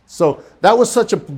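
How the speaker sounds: usual speaking voice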